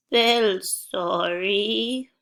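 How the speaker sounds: put-on very slow, shaky-free elderly drawl for kids; old person voice. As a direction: stretch it out like a little old grandpa. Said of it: "In an old person voice".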